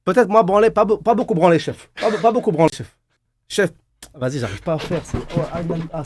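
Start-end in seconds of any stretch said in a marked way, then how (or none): 0.0-3.7 higher-pitched mock Indian accent in French; broken French with a put-on Indian accent. Shown as a demonstration. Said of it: "tu fais un accent en indien". (accent indien imité, en français)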